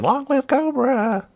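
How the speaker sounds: high, long-drawn cheer without real volume; mock distant shout. A narrator's reading. Troops on the sea base cheering their cause, mock distant shout. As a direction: call it out high and stretched, as from afar. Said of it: "in the distance are shouting".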